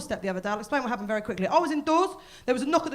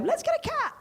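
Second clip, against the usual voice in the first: high-pitched chirpy suggestion; mock enthusiasm. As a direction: pitch it up, absurdly eager. Mock couple cheerfully proposing a cat.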